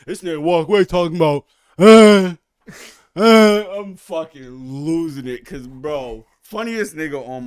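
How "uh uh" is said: loud, high retold groaning; mock dazed groan. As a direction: groan like you just got stomped. Beaten neighbor groaning.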